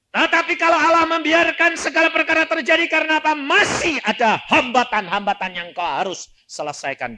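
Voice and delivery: high, loud challenge from the pulpit; full-force preaching. Acted. Preacher challenging listeners about hindrances, preaching loud. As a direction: drive the question home, loud and high.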